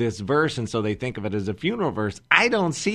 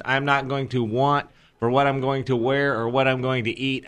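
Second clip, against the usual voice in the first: normal talking voice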